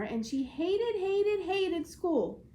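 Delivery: slow building stress on "hated"; building emphasis. A narrator's reading. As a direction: lean harder into each "hated". Repeated word for emphasis.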